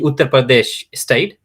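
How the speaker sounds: usual speaking voice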